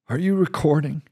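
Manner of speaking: steady reassuring commander; calm commander. Acted. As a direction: soothe the angry reporter. Commander asks privately.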